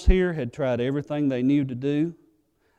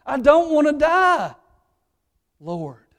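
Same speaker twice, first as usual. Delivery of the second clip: voiced as terrified Peter, much higher and louder; terrified plea; 11 semitones higher, 8 dB louder, slower than usual. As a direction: cry it out like a man afraid to die. Peter terrified before God.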